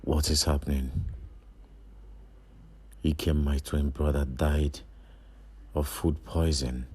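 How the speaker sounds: very low, hushed voice; low, subdued musing with long pauses; sombre brooding. Acted. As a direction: think aloud in mourning, softly. Brooding alone over brother's death.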